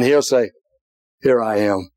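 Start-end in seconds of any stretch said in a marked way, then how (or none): 1.2-1.9 gentle answer. Retold or imitated. Jesus answering the believer, low gentle reassurance. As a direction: answer like a calm father.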